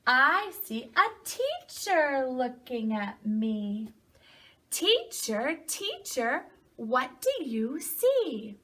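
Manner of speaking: slow, lilting read-aloud with stretched words; storybook reading to children. A narrator's reading. Teacher reading a picture book to children, slow singsong storytelling. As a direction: read slowly, swooping up on key animal names.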